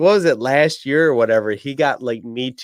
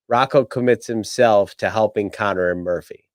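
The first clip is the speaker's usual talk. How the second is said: ordinary talk, nothing marked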